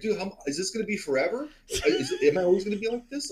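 high-pitched imitation of mild panic; worried tripping friend. Tripping Richard asks friend if it's forever, retold worry. Voicing Richard fearing endless trip.

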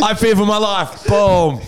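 high-pitched mock panic cry; mock self-defense yell. Comic pretends to justify kicking a heckler, mock self-defense yell. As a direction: cry out a pretend plea mid-kick. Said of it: "if you yell self-defense as you fly kick".

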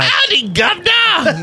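slow, high, hammed-up mock English accent; a terrible cowboy-meets-Cockney greeting; bad English accent. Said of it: "just going downhill".

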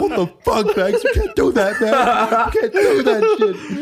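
very high panicked pleading; rising panic, voice climbing; begging Banks to stop, high and panicked; full panic. Retold or imitated. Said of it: "I was in full panic".

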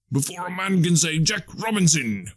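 high, hearty urging; jovial old master. Acted. Fezziwig hurries the lads with the shutters, jovial and high.

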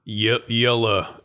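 "Yella" said slowly as YEL-la; correcting to "Yella", slow and deliberate; Yella corrected. Shown as a demonstration. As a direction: spell out "Yella" carefully. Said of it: "Y E L L A".

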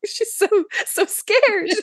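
very high, drawn-out mock fright; mock scared. Co-host spoofing the caffeine pills scene, mock scared. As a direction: play a hammy, slow meltdown.

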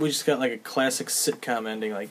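ordinary delivery

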